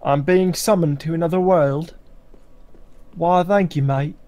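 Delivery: lightly put-on blend of British and Australian; British-Australian accent. Gamer showing off his accents as he gets summoned, mock British-Australian accent. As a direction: attempt a bad British accent mixed with Australian. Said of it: "mixture of the British and Australian accents".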